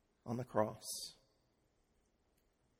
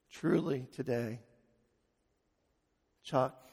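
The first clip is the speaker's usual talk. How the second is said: softly, fading away to almost nothing; hushed and gentle, as if heard in prayer; tender whisper-soft promise; 4 semitones lower, 12 dB quieter than usual. Retold or imitated. The Spirit's quiet promise to the preacher, fading softly.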